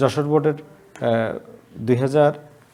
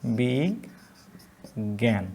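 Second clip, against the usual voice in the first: বিজ্ঞান drawn out slowly and softer; dictating বিজ্ঞান slowly; slow dictation; 3 semitones lower, 6 dB quieter, much slower than usual. Shown as a demonstration. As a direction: stretch বিজ্ঞান quietly. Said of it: "বিজ্ঞানে থেমে যাচ্ছে".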